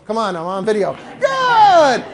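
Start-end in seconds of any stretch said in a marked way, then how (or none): 1.2-2.0 very high, loud "Good"; happy, excited. Shown as a demonstration. Trainer urging the crowd to join, excited whoop. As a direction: whoop it with a long falling tail. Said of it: "Happy, excited".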